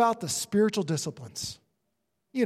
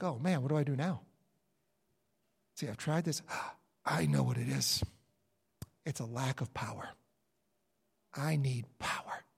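quiet muttering; 7 semitones lower, 8 dB quieter than usual. Acted. Frustrated believer mutters to himself.

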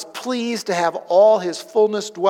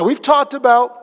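usual speaking voice

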